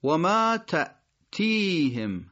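تأتیهم slowly, glottal stop after "ta"; still hamza. Shown as a demonstration. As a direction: stop on the hamza in تأتیهم, then stretch "tii". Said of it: "همزش در تأتیهم همزهٔ ساکن هست". (و ما تأتیهم شمرده، با همزهٔ ساکن)

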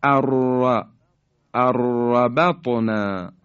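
slow اربطنا with long trilled r; nun dropped. Shown as a demonstration. Tajweed teacher demonstrating idgham, arrabatna for اربطنا. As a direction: roll a doubled r in اربطنا, no n.

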